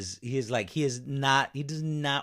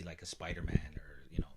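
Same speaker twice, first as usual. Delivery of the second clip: whispering; 4 semitones lower, 8 dB quieter, faster than usual. Real, not acted. Trailing aside.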